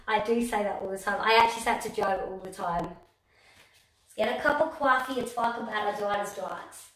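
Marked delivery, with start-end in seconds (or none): 2.8-6.9 put-on New York coffee-talk accent, trailing off; playful imitation of a New Yorker's accent. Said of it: "Was that a good accent?".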